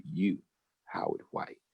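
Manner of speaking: low, almost whispered naming; hushed. Retold or imitated. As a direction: say his name softly, pointedly. Teacher singles him out.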